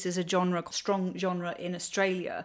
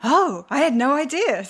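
mock surprise; 7 semitones higher, 7 dB louder than usual. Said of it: "And I was like".

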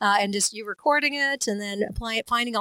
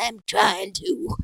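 put-on old man voice; 4 dB louder, much slower than usual. Old character answering back.

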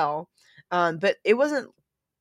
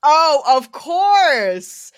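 knowing realization; 9 semitones higher, 11 dB louder, much slower than usual. Real, not acted. Realization, drawn out.

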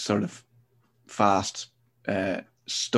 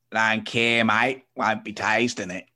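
a hint of a broad Sydney accent; slower than usual. The bar customer answering the barman in a light Sydney accent. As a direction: shrug it off like a thirsty Aussie. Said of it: "the really harsh Sydney accent".

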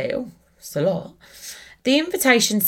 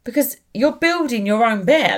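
put-on Brody's little accent; 5 semitones higher, 4 dB louder than usual. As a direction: draw out an imitated little boy's refusal, lightly accented. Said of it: "in his accent, 'cause he has a little accent".

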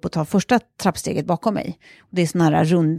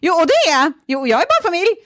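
high, loud acted rebuttal; defiant insistence; 11 semitones higher, 4 dB louder than usual. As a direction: insist emphatically, pitched right up. Retold indignant rebuttal to the intruder.

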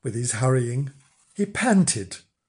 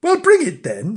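bossy wife; 8 semitones higher, 6 dB louder than usual. Acted. Wife demands umbrella.

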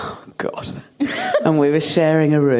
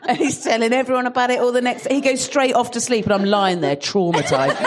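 ordinary delivery

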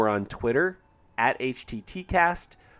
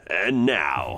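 low, dramatic trailer announcer; movie trailer voice; 6 semitones lower than usual. Pretend. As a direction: boom it like a film trailer. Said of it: "sounded a little bit more like movie trailer".

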